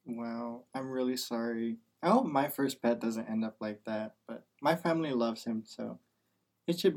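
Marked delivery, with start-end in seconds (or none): none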